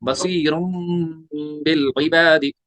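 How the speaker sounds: slow recitation, बसीरुन held as a hummed M before बिल; iqlab with ghunna. Shown as a demonstration. Teacher reciting the finished rule, बसीरुन with a long hum. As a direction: stretch बसीरुन on M, then बिल इबादी. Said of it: "plus गुन्ना करेंगे".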